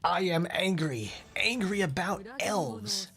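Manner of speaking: high, slow parody of a sulky angry guy; mock angry. Streamer imitating an angry player with a high, slow pout. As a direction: drag it out like a pouting grump. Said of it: "he is angry".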